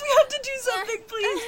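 frantic begging, near tears; panicked pleading. Acted. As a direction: beg in a panic. Panicked plea under attack.

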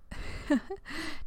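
high giggle; bright breathy giggle. Real, not acted. Laughing at listener.

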